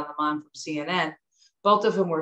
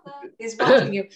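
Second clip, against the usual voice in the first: put-on mom's warning in Jersey accent, louder and higher; 5 semitones higher, 3 dB louder than usual. Daughter repeats mom's warning before dates, Jersey accent. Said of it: "She would always say that".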